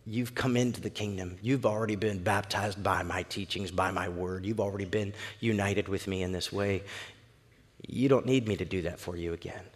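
low, hushed explanation; quiet, intimate gravity; patient Jesus. Acted. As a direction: reassure him very softly, almost murmuring. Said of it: "In other words".

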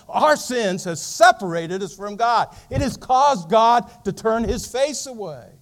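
building into a loud sermon climax; fiery preaching. Acted. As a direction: preach it hard to the congregation. Preaching builds to full force.

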